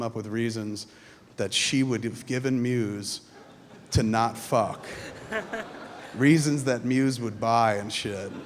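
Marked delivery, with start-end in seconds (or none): none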